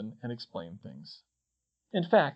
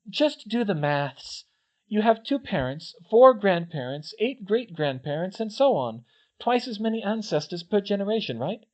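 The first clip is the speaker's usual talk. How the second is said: put-on eager clever girl voice; 7 semitones higher, 4 dB louder than usual. A narrator's reading. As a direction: explain it like a keen student. Narrator voices Hermione explaining.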